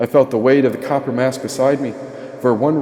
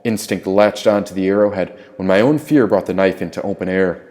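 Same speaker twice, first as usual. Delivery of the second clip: usual speaking voice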